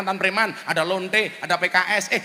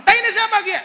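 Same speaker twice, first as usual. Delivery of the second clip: high-pitched, louder shout; indignant yell; 12 semitones higher, 5 dB louder than usual. Retold or imitated. Cilacap man refuses the Sundanese answer, indignant yell. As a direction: call out in outraged disbelief. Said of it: "Orang Cilacap teriak".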